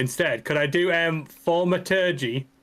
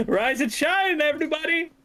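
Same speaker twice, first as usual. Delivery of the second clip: wake-up call; 13 semitones higher than usual. Acted. Waking the sleeping party through the window, high sing-out call. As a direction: call out high to rouse everyone.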